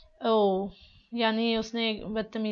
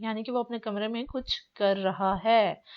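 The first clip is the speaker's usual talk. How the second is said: ordinary delivery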